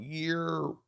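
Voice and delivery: Philly greeting "Year". Shown as a demonstration. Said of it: "their way of saying what up".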